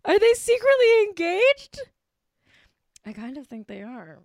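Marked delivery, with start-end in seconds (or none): 0.1-1.8 high, loud mock-scandalised question; gossipy excitement. Host speculating on a secret celebrity engagement, excited and gossipy. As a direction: pitch the question way up like juicy gossip.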